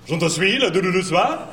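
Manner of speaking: high, rattled fake French; waiter's rapid French. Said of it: "He goes".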